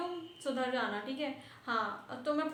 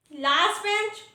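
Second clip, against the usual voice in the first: higher, sharply questioning teacher; calling out pupil; 5 semitones higher, 4 dB louder than usual. Acted. Teacher to last bench for laughing, stern call-out. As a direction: pull up the back bench with rising questions.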